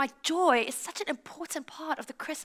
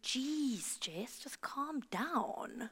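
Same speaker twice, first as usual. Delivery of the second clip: very soft, slow imitation of a mortified friend; hushed reproof; 14 dB quieter, much slower than usual. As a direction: quietly tell an overexcited friend to settle. Friend tells her to calm down.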